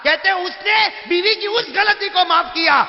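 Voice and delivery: high, loud, slow preached shout; fiery preaching. Acted. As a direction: thunder it out to the crowd. Preaching climax. (پورے جوش سے وعظ کرتا ہوا خطیب)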